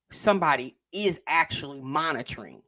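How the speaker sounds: ordinary delivery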